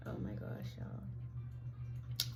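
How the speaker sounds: breathless, almost silent whisper; overwhelmed whisper. Real, not acted. To her viewers, overcome, barely whispering. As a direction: emotion steals the voice away.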